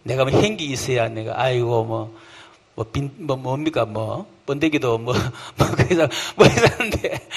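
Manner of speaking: mumbling, unworthy believer; a hint of a guilty, hesitant voice; self-deprecating mumble. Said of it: "스스로 양심의 가책이 느껴가지고". (주눅 든 듯 웅얼거리며)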